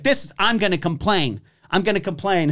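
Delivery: retold mother threatening to complain to security; mocking indignant mom. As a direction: parody an entitled complaint. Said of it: "and the mom's like".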